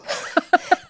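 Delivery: breathy high giggle; light laugh. Real, not acted. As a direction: laugh at your own joke. Laughing at own joke.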